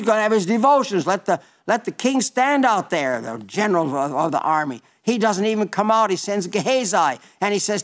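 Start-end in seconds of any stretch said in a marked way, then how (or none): none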